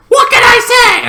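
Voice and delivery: very high, loud silly quote; mock high voice. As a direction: squeak it out helplessly. Speechless mock reply.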